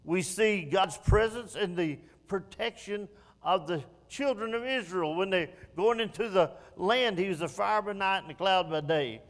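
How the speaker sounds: fervent pulpit preaching; full-force preaching. Acted. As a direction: preach it out to the whole congregation.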